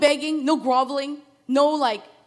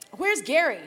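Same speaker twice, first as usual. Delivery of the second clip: higher and louder, calling through the house; 5 semitones higher, 7 dB louder than usual. Retold or imitated. Father calling out for his older son, loud. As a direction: call for him across rooms.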